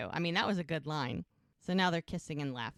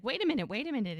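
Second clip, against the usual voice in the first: mock urgent call, higher and louder; retold plea to stop someone leaving; 6 semitones higher, 5 dB louder, much faster than usual. Retold man calling after departing woman.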